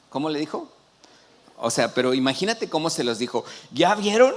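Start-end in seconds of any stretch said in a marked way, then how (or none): 3.7-4.3 raised, louder excited question; excited call to the crowd; acted excitement. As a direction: ask it like a thrilled prophet. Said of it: "este, emocionado".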